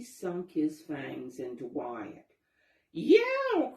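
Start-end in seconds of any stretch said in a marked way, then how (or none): 2.9-3.7 high, loud yelp; cry of pain. A narrator's reading. Wyatt the fox bitten, loud high yelp. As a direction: yelp at the sudden bite. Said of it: "cried Wyatt".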